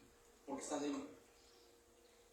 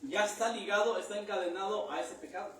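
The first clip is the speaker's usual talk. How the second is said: raised, louder preaching voice; 3 semitones higher, 6 dB louder than usual. Acted. Preacher warning his congregation about sin, raised preaching. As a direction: press the point on the congregation.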